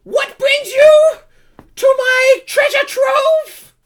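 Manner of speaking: slow, loud, very high kobold demand; Kakarol. Acted. Kobold leader questioning intruders at his treasure, slow high shout. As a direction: draw it out imperiously in a shrieky little creature voice. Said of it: "he says".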